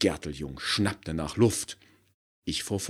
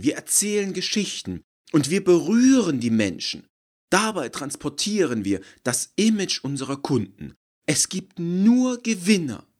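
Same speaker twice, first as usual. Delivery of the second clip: gushing marketer; 13 semitones higher, 10 dB louder than usual. Acted. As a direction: savour every glowing word. Gerteljung's closing statement.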